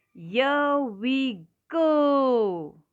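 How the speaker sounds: long, loud, high sledding cry; hugely drawn-out storybook cheer; excited whoop. A narrator's reading. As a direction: stretch every word out as the sled takes off. Sled sets off downhill in story.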